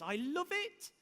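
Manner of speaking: high, loud burst of enthusiasm; delighted relish. Real, not acted. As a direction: exclaim it, pitch leaping up. Relishing a favourite quoted sentence.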